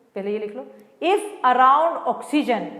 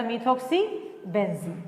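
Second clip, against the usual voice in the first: slow soft answer; 3 semitones lower, 6 dB quieter, much slower than usual. Shown as a demonstration. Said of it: "answer restated slowly".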